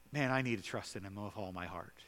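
soft whispered confession; whispered aside. Real, not acted. Preacher confessing his own need to trust, whispered. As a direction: breathe it to yourself. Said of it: "personal aside to himself".

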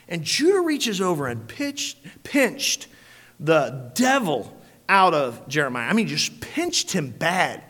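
normal talking voice

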